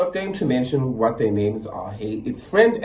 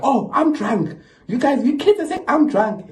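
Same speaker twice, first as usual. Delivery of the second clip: high, indignant mock outrage; offended drunk aunt; 12 semitones higher, 4 dB louder than usual. Drunk aunt scolding the kids, higher and indignant. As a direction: play the insulted drunk aunt.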